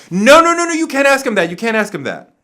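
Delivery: loud, high, panicked no no no; a crisis manager jumping in to stop the question; alarmed protest. Retold or imitated. R. Kelly's crisis manager cutting in, loud and alarmed. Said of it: "jumped up and said".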